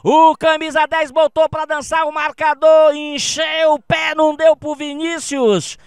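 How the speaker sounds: high, loud, thrilled play-by-play; excited goal replay. Real, not acted. (narração empolgada do lance)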